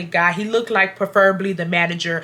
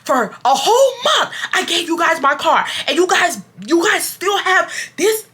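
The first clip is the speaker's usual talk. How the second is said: higher, mock outraged complaint; retold customer going off on the manager, high and indignant; imitating angry customer; 8 semitones higher than usual. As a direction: play the fed-up customer ranting, pitched up. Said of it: "she's going off, y'all".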